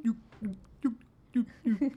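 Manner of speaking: high squeaky guinea pig squeaks. Retold or imitated. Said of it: "like those weird fucking guinea pig noises".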